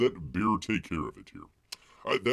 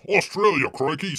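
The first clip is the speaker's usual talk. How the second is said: put-on Australian accent; 9 semitones higher, 10 dB louder than usual. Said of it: "says Peabody".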